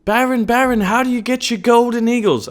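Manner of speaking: high, loud put-on voice; hammed-up, high-pitched pestering; eager fan's question. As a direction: call out like an excited fan. Acted fan question in plug.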